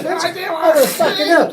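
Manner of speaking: high, loud shocked yell from across the pitch; loud shout. Retold or imitated. Man shouting across a football pitch in shock, loud.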